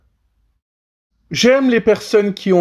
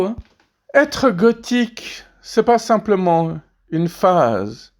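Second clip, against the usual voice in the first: ordinary talk, nothing marked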